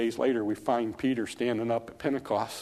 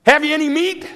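much higher, loud call across the water; crying out; 10 dB louder than usual. Acted. Man on the shore calling to the fishermen, loud high call. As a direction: hail them from the shore. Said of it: "he cried out to me".

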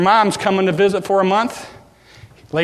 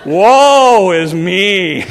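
mock lament; 6 dB louder, much slower than usual. Husband sulks over bad news.

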